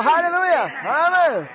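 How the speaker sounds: high joyful cry of hallelujah; shouting hallelujah. Retold or imitated. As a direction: shout it up to heaven. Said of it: "他喊叫起来". (高声欢呼哈利路亚)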